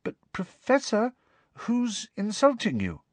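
high, hesitant little question; timid. A narrator's reading. Parvati asks the professor who insults her, timid. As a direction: ask timidly in a high girlish pitch. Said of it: "asked Parvati timidly".

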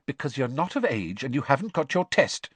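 mildly snappish mother; snapping. A narrator's reading. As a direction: shut the complaint down crossly. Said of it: "snapped Missus Weasley".

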